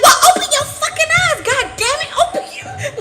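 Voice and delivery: high, loud, fast exasperated yell with laughter; grandma losing it. Retold or imitated. Grandma yelling at Maya, loud high exasperated. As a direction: blow up like a fed-up grandma. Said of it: "she lost it".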